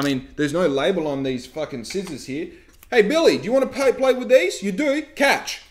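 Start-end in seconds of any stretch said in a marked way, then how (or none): 2.9-5.6 much higher, louder, fast cheery voice; mock careless parent. Vlogger mocking a careless parent, high cheery fast. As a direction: play a breezy parent tossing scissors.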